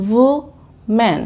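"woman" split as wo, pause, man; syllable by syllable. Shown as a demonstration. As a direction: say "woman" in two separate beats. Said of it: "প্রত্যেকটি শব্দ এক একটা খণ্ড আকারে".

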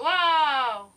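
sudden loud wow; a loud startled cry; startled shout. Real, not acted. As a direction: jump at the surprise. Startled by the buttons.

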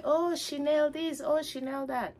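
high, airy "oh Chanel" with shin-ELL; breezy put-on voice of careless people; mocking the masses. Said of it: "we can say".

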